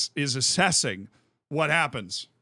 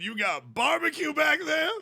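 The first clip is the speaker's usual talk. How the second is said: Gus Johnson call; 10 semitones higher than usual. Retold or imitated. Retelling the call again, high-pitched announcer. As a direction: replay the call with glee. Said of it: "He said".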